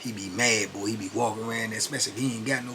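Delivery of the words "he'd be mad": ordinary talk, nothing marked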